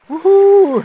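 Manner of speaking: high loud whoop at cold water; excited whoop. Real, not acted. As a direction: shout from the shock of cold. Steps into icy river. (cri de joie)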